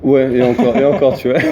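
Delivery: chuckling through the words; laughing. Real, not acted. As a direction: laugh as you say it. Joking about not backing the team.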